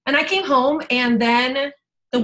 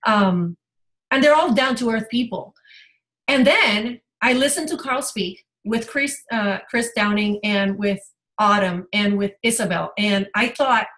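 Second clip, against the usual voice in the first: normal talking voice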